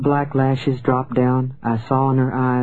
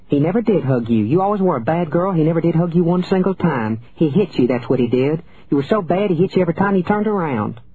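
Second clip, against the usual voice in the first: cross, high-pitched scolding; furious mother; 5 semitones higher than usual. A narrator's reading. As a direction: lash out at the child, pitch climbing. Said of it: "her furious mother".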